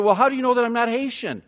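usual speaking voice

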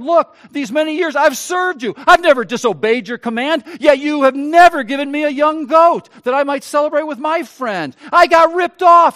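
put-on loud, rising grievance of the older brother; resentful elder son. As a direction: complain to father that you were cheated. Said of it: "but he answered his father".

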